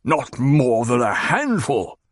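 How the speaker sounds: slow, doubtful repetition; repeated. Acted. Baron repeats Hawat's claim, slow and uneasy. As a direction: echo it slowly, unsettled. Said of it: "the Baron repeated".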